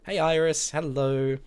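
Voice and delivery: a tiny stickman waving hello; cartoon greeting.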